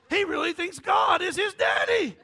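the mind marvelling aloud; hammed-up astonishment; mock amazed mind. Voicing his mind, mock amazement.

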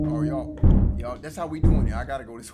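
ordinary talk

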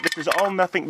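ordinary delivery

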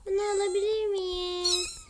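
put-on high, slow little-girl begging; pleading child. Little girl doll begs her mom to buy it, high and slow. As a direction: ask sweetly like a child wanting a toy.

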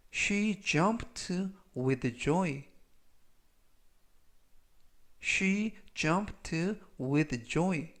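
slow, carefully spaced English model sentence; language-lesson model reading. Shown as a demonstration. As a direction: read it slowly for learners to repeat. Model sentences read for learners.

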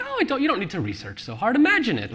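high, fast sing-song mockery of lazy writers; mocking dismissive advice. Author mocking writers who skip research, high sing-song. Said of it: "when folks say".

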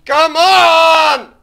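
high screaming plea; desperate shout. Real, not acted. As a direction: scream it in panic. Urging escape.

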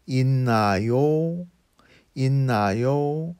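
있나요 with the final 요 drawn upward; rising intonation. Shown as a demonstration. Teacher showing learners an informal question ending, rising intonation on 있나요. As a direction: let 있나요 climb at the end like a question. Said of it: "in a rising intonation".

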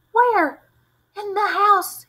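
high, loud frightened cries; terrified. A narrator's reading. Two kids terrified of a ghost, high loud frightened cry. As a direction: cry out in terror.

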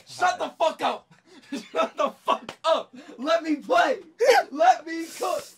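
high, loud exasperated outburst; angry yelling. Real, not acted. Frustrated player yelling at teammates to let him play, angry shouting. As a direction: yell at them to back off.

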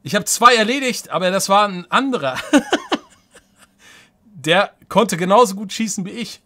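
high, loud talk through laughter; laughing excitedly. Real, not acted. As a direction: giggle through the disbelief. Lacht über den Kampfausgang. (lachend aufgeregt)

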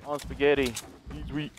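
ordinary talk

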